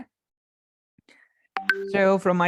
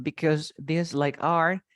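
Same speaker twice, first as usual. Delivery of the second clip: ordinary talk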